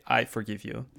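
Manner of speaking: ordinary talk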